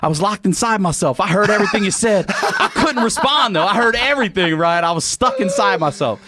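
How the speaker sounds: high, strained comic distress; hammed-up shaken insistence; retold frantic explanation. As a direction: plead it high, as if just revived. Cousin's frantic account after waking, retold.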